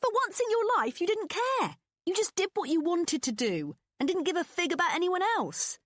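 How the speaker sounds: high, loud gleeful praise; excited. A narrator's reading. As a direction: cheer her on. Pippa cheering friend on.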